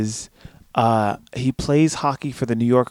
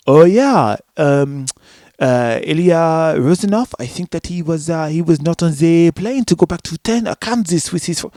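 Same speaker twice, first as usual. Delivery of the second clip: mock French accent, halting and louder at first; bad French accent; 3 semitones higher, 4 dB louder than usual. Imitating the locker room guy, terrible French accent. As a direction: do a hammed-up bad French accent, stumbling through. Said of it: "one of the worst French accents".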